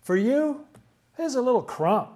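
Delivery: high, lilting paraphrase; imagined plea. Retold or imitated. Woman tells Jesus it is small for him, high imitated plea. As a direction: lift the voice well up to play her part.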